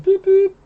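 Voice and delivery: high toy train horn noise; train horn boop. Pretend. Co-host joins the donation train joke with a high train toot. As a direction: toot it like a little train whistle, high and playful.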